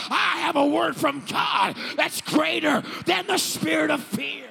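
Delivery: slow, high, roaring sermon shout; full-force preaching. Real, not acted. Pastor urging the congregation to defy fear and hell, full-force preaching. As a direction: thunder each sentence out in defiance.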